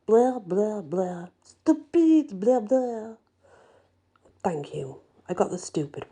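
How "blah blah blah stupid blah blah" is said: higher pitched scolding gibberish; hammed-up grumpy cabbie scolding; mock French scolding. A narrator's reading. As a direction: wag a finger at her in mock French. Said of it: "Sounds a lot like".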